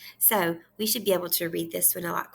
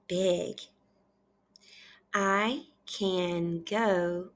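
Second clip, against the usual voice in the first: very slow, one word at a time; long gaps between words for reading along; patient word-by-word read-aloud; nice and slow; much slower than usual. Shown as a demonstration. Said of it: "read it nice and slow".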